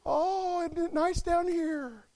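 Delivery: high, soft, drawn-out mock delight; airy imitation of a carefree drifter; oblivious slider. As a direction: coo it as if gliding blissfully downhill. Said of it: "you're just like".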